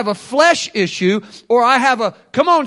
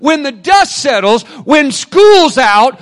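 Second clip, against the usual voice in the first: louder, higher, slowing on key lines; full-force preaching; 6 semitones higher, 9 dB louder than usual. Real, not acted. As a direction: drive it home like a preacher.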